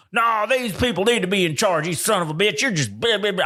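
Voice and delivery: put-on Southern accent. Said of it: "I'm doing a Southern accent".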